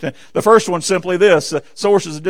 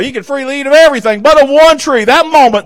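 fervent preaching; 7 semitones higher, 5 dB louder than usual. Real, not acted. Preacher rising to a peak.

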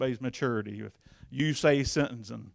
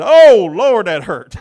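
sudden high, loud yelp of pain; pained outcry; 8 semitones higher, 24 dB louder, much slower than usual. Retold or imitated. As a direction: cry out as the hammer hits your thumb. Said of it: "and I said".

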